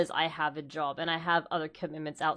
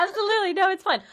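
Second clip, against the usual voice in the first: laughing reassurance; 13 semitones higher, 6 dB louder, faster than usual. Real, not acted. Cohost asks for a break.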